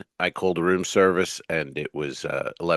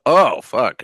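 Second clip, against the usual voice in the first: loud; 5 semitones higher, 8 dB louder than usual. Retold or imitated. Retelling realising he overslept.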